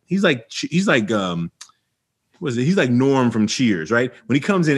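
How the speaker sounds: ordinary talk, nothing marked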